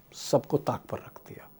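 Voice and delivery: dramatic whisper. Real, not acted. Dramatic close of an angry list.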